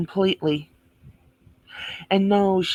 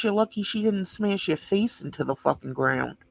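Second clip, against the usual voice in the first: ordinary talk, nothing marked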